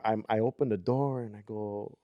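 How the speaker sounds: plain reading voice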